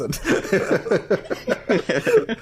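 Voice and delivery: stretched, laughing mock announcer call; laughing announcement. Real, not acted. Cohost announcing the bit while laughing. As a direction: drawl it out like a game show title.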